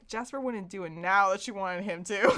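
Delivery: normal talking voice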